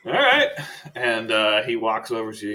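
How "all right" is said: high, loud upbeat okay; chipper agreement. Retold or imitated. Freed prisoner brightly agreeing to come along. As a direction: accept with sudden cheer. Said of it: "and goes".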